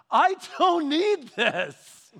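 loud, slow, disbelieving cry; loud, drawn-out exasperated protest; exasperated outburst. Retold or imitated. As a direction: throw up your hands in disbelief. Shocked at finding dirty magazine.

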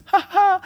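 high gloating ha ha; imitated cheeky laugh; mock gleeful ha ha. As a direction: gloat like a sly designer.

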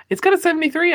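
high, fast and eager; excited. Real, not acted. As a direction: blurt it out with a gasp. Gasp, quick excited defense.

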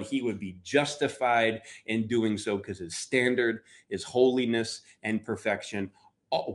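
usual speaking voice

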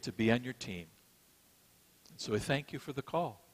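gentle sermon-closing prayer. Acted. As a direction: pray humbly over the congregation.

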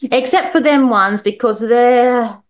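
put-on drunk dad.

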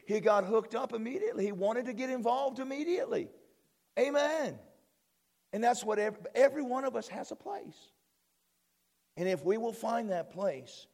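high-pitched, fervent preacher delivery; excited preaching. Real, not acted. Pastor tells church of eager helper, excited preaching. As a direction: lift the pitch and exhort them.

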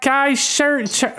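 high, fumbled Jamaican turning Irish; botched Jamaican accent. Retold or imitated. Comic imitating a Jamaican nurse, badly botched Jamaican accent. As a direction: attempt Jamaican and slip into Irish. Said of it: "she sounds Irish now".

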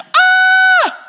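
shrill high playground scream at full blast; girly scream. Retold or imitated. Disciples see a ghost on the water, piercing high scream. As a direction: scream like a schoolgirl at recess. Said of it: "like a grade school girl out on the recess playground".

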